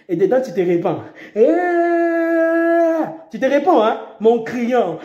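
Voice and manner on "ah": high pretend cry of terror; a short staged scream; mock crash scream. Preacher acting a crash victim's scream.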